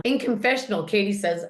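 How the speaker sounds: ordinary talk, nothing marked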